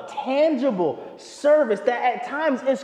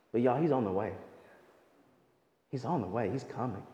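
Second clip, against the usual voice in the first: much softer, low, tender promise; soft reassurance; 10 semitones lower, 10 dB quieter, faster than usual. Real, not acted. Preacher softly assuring the congregation he is coming. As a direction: hush it down like a comforting secret.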